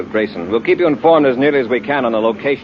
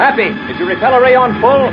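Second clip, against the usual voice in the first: urgent call; 10 semitones higher, 3 dB louder than usual. Acted. Commander calls cadet in emergency.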